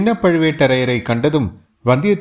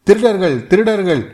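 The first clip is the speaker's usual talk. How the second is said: shouting with all his might; 7 semitones higher than usual. A narrator's reading. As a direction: raise the alarm at full voice. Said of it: "தன் குரலின் சக்தியையெல்லாம் உபயோகித்து".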